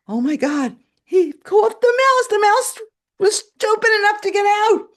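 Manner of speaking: high, loud shocked outburst; alarmed exclamation. Retold or imitated. Owner sees her cat caught the mouse, high alarmed exclamation. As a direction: build from alarm into a high cry. Reliving shock at cat's catch.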